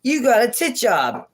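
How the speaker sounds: high, mock-outraged voice with a hammed-up fall on "tit"; mock outraged mom. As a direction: play the mom freaking out, mockingly. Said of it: "She's like".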